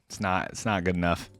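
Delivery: normal talking voice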